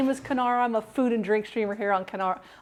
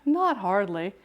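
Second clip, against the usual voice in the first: ordinary delivery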